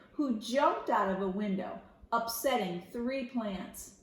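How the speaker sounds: plain reading voice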